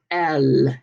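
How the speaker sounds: "L" with a long, strong final l; low, drawn-out French "L"; strong L sound. Shown as a demonstration. Teacher showing the letter, strong held l in "L". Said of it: "is that strong L sound".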